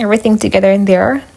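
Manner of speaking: normal talking voice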